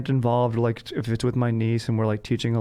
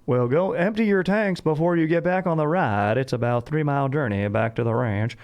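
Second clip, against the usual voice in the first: cowboy voice; 4 semitones higher than usual. Retold or imitated. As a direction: imitate a ranch guide hamming it up for guests. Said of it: "a cowboy sort of voice".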